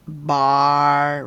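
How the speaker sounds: BAR held long and loud on one level note; syllable sounded out. Shown as a demonstration. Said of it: "I split it up into syllables".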